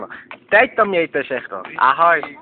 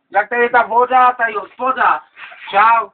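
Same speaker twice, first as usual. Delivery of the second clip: ordinary talk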